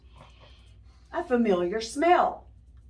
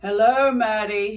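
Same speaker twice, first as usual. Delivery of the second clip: calling out hello, loud and bright; father calls out hello to Maddie on getting home; loud greeting; 7 dB louder than usual. A narrator's reading. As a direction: call out to Maddie as you come in.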